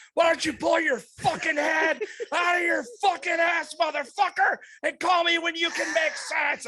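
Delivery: high, shouted sign-off before hanging up; retold losing it. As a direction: yell a final furious insult down the phone. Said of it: "I'm fucking losing it".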